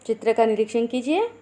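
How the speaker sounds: usual speaking voice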